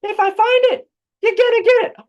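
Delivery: very high, loud shouting mum; yelling across the room. Retold or imitated. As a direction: yell the threat across the house. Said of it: "Like when I say".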